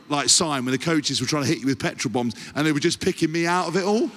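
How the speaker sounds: usual speaking voice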